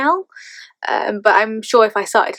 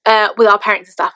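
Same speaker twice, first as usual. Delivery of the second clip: ordinary talk, nothing marked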